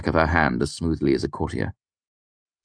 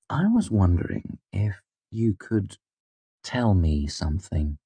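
conspiratorial; 4 semitones higher than usual. Acted. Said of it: "in my best conspiratorial tone".

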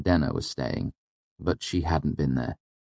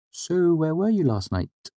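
breezy, offhand question, higher voice; too casually; 13 semitones higher than usual. A narrator's reading. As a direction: ask as if it barely matters. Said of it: "Simon asked too casually".

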